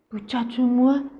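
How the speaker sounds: ordinary delivery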